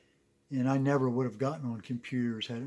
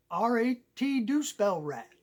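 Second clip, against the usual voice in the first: high, slow, insistent country buddy; hammed-up stubborn good ol' boy; gas station buddy; 9 semitones higher, 4 dB louder than usual. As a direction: insist on it as the hometown pal, drawn out and high. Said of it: "his buddy stays and works at the gas".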